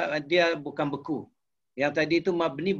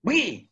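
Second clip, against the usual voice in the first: "pergi" as a sharp order, high then falling steeply; short, high-pitched command on "pergi"; commanding intonation; 5 semitones higher than usual. Shown as a demonstration. Teacher showing how intonation makes an order, commanding "pergi". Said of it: "Bila kata intonasi".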